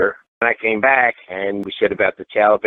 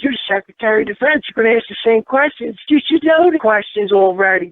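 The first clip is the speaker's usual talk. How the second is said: high mocking outrage at the secretary; indignant retort; 13 semitones higher than usual. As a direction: scold him for not knowing. Retold angry retort to Panetta.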